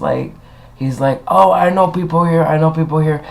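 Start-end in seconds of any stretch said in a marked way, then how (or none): 1.3-3.2 higher-pitched mock threat; mocking complainer. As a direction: imitate a whiny self-important customer.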